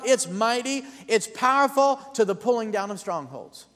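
full-force preaching. Real, not acted. Preacher rousing the congregation about the sword of the Spirit, full-force preaching.

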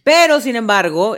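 ordinary talk, nothing marked

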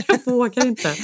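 slow, laughing mock fright; laughing fear. Afraid to look at the machine, slow laughing mock fear. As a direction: giggle out the confession of being scared.

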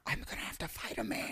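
fast, breathless whisper; mock-terrified aside; whispered inner panic. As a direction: hiss it to yourself in dread. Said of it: "And I'm like".

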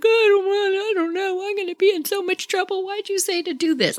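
high, fast, whiny panic; a scared kid on the verge of tears; panicky Candy. Retold or imitated. Scared friend panicking in the dark.

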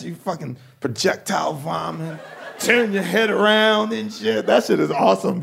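normal talking voice

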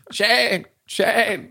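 high bleating sheep voice, calling Shane; sheep bleating name. Retold or imitated. Sheep in the fields bleating the hero's name. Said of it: "the sheep, they're sort of going".

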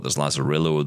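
normal talking voice

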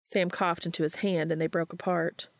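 ordinary delivery, nothing marked

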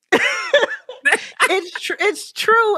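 high laughing words. Real, not acted. Guest laughing mid-sentence.

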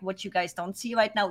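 normal talking voice

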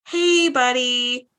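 very slow, drawn-out casual greeting; slow stroll. Retold or imitated. Imitating the Chancellor's visitor who strolls in slowly. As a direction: dawdle through it like there's no hurry. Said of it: "She strolled in like".

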